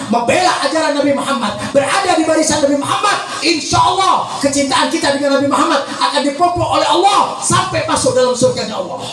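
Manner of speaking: sustained, insistent sermon delivery; impassioned preaching. Acted. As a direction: exhort the congregation in rolling phrases. Preaches at full force. (ceramah penuh semangat)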